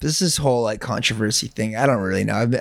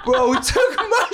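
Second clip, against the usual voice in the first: higher, giggly laughing voice. Real, not acted. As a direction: squeal it out through giggles. Repeats it laughing.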